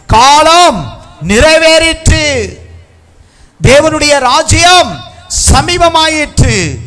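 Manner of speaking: high, loud, drawn-out preaching shout; scripture proclamation. Real, not acted. Preacher crying out Mark's verse, slow ringing shout. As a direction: herald the verse slowly at full voice.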